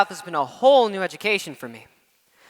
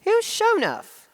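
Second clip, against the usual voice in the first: puzzled Southern lady; 13 semitones higher than usual. Retold or imitated. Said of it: "she just looks at me and says".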